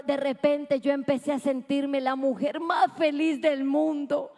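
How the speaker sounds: ordinary talk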